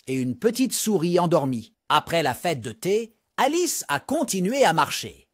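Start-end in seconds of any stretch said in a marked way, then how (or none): none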